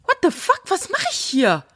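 high, startled cry of disbelief; mock shock at the cold; shocked thought. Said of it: "denkst du".

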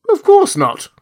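very high, loud protest; scandalised denial. A narrator's reading. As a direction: deny it as a flustered official. Official hastily denies the wizard rumour.

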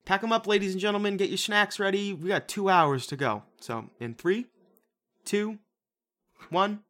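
high fast announcer call. Pretend. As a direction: hype up the crowd. Announcing the movie start.

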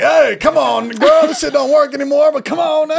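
loud, high-pitched mock politician voice; Biden. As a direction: holler it as a hammed-up impression. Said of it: "D Ms from Biden being like".

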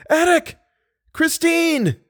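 one last cry with all your strength. A narrator's reading. Drowning narrator's final call to Eric and Christine, despairing. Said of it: "a last cry".

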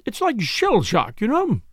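obstinate. A narrator's reading. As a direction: stick stubbornly to the excuse. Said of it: "said Missus Ackroyd obstinately".

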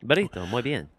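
put-on Spanish accent. Shown as a demonstration. Co-host echoing the practice word, Spanish accent. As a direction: play along in a mock Spanish accent. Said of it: "That's a good door into that accent".

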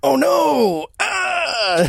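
very high, hammy cry of agony; mock pained wail. Henchman losing another finger, overacted high wail. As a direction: wail in badly faked pain. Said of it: "he reacts the same way".

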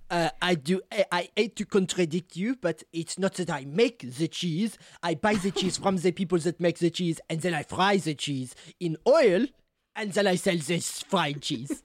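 put-on French-accented English, fussy cheese seller. Cheese seller correcting a customer, French accent. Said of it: "The Fringlish is so good".

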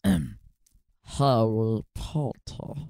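low, very slow, throaty drawl; Severus Snape. Shown as a demonstration. Host demonstrating a Snape impression, throaty drawl. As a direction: draw each word out deep in the throat. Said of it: "trying to do Severus Snape".

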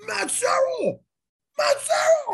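very high, loud star-struck yell; excited fan shout. Retold or imitated. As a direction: holler the name like a thrilled fan. Giant fan spots him, calls out.

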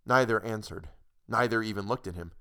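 ordinary delivery, nothing marked

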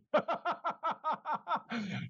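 very high giggling laugh; laughter breaking out high; high burst of laughter. Real, not acted. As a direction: crack up at your own punchline. Laughing at own joke's punchline.

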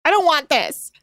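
high, insistent whine; high exasperated refusal; protesting. Real, not acted. Protesting co-host's attention.

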